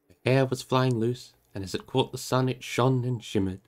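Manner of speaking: measured storytelling read, unhurried; calm audiobook narration; story narration. A narrator's reading. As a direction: read it like a bedtime chapter. Continues reading the story.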